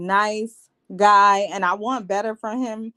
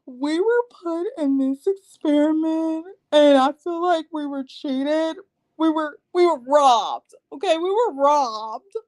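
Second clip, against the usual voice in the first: higher, mock-whiny self-pity; mock Alyssa; 4 semitones higher than usual. Mocking Alyssa's reunion speech in a whiny voice. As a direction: imitate her pleading innocence. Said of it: "Alyssa said".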